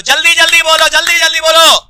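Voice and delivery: loud, high urging; urgent prodding. Real, not acted. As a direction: hurry the class along at full voice. Teacher urging class to answer quickly.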